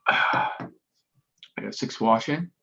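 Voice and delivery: ordinary talk, nothing marked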